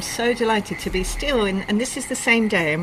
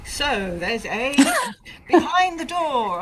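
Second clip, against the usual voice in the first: slow, higher game-show announcer voice, broken by a laugh; mock quiz host; 7 semitones higher, 6 dB louder, slower than usual. Host playfully imitating a British quiz show host. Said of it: "a British, like, quiz show".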